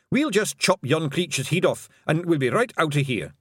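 high, brisk Scottish wee-man voice; cocky Scots Feegle; Scottish pixie. Acted. Rob Anybody speaks.

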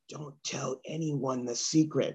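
slow, low, hushed secret; movie campaign tagline. Retold or imitated. Comic recalls the old film campaign, hushed tagline. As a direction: drop low and soft like a film teaser. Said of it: "Remember that campaign?".

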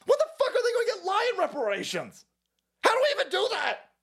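high whining complaint. Retold or imitated. Comic mocking Christians, high whining voice. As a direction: whine it like an aggrieved believer.

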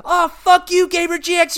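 furious mock rage at the other team; enemy cursing yell. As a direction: yell it at your killer. Voicing enemy cursing him on mic.

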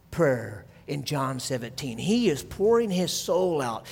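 ordinary talk, nothing marked

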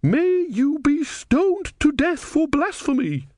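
very high, slow indignant woman; heated. A narrator's reading. Devout woman cursing the novice for blasphemy, heated. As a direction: pronounce the curse hotly, pitched far up. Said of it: "said the woman hotly".

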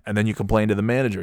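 ordinary delivery

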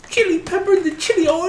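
put-on squeaky high-pitched old man, half laughing; super high voice. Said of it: "talks in this, like, super high voice".

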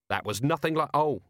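usual speaking voice

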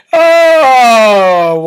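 real winded "oh"; post-laughter sigh. Real, not acted. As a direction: let out a drawn sigh after laughing hard. Recovering from laughing fit.

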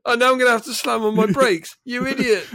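high, exasperated driver's rant; hammed-up fury at a stubborn pigeon; mock road rage. Acted driver berating a pigeon.

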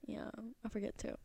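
unvoiced whispered aside. Real, not acted. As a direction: breathe it as a whisper. Sudden whisper.

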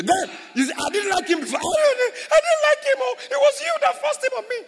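very high, loud whining shout at God; mock complaint shout. Preacher mimicking a believer blaming God, high loud whine. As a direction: whine and yell the blame at God.

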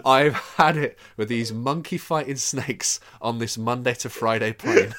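censored dub voice, played for laughs; dubbed TV line. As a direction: ham up the censored TV dub line, slow and loud. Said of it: "is changed to".

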